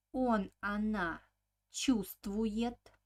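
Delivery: usual speaking voice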